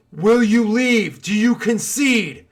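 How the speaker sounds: guard's drawn-out commanding shout; shouted demand. Acted. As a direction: bellow questions as an ultimatum. Guard demands surrender from enemies.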